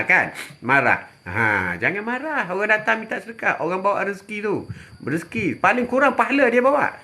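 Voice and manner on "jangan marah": usual speaking voice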